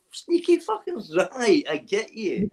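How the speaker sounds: high, put-on voice, slow at the end; sly mock reply, drawn out; mock knowing voice. Imitating his own reaction, squeaky and slow.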